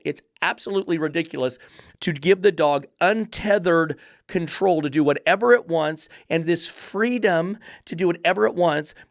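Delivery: usual speaking voice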